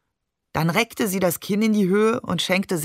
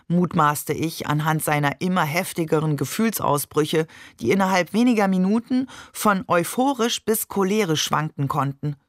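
ordinary delivery, nothing marked

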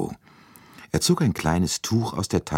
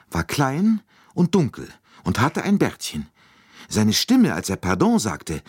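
high, insistent maid's voice, sure of herself; a maid who will not be talked out of it; firm lady's maid; 10 semitones higher, 3 dB louder than usual. Acted. Maid insists on what she saw.

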